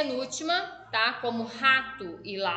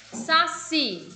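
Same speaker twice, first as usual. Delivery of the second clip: Saci raised and loud, strong "ci"; stress on last syllable; 4 semitones higher, 5 dB louder than usual. Shown as a demonstration. Teacher showing pupils the tonic syllable, loud and emphatic. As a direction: punch the final syllable of Saci. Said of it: "O si é a sílaba tônica".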